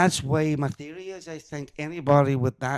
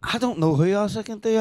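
ordinary delivery

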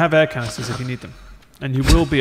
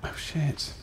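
whisper; 14 dB quieter than usual. Real, not acted. Whispered reaction.

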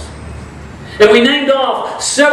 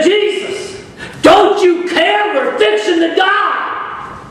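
panicked disciples; 7 semitones higher, slower than usual. Retold or imitated. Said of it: "He said".

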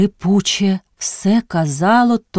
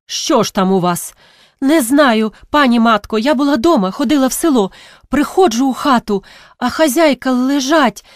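frightened telling; 5 semitones higher than usual. Acted. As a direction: blurt out the horror, trembling. Girl reports mistress lying terrified. (налякана розповідь)

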